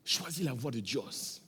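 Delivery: lightly voiced old man, low, soft and slow; a hint of a slow, quiet elder giving counsel; old man's reply. Old neighbour raking leaves answers the young man, slow and low.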